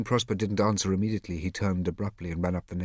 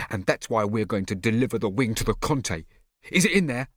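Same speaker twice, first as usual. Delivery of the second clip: heated; 3 semitones higher, 6 dB louder than usual. Acted. Heated quarrel.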